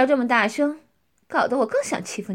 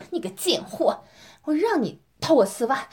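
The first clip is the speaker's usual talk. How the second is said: ordinary delivery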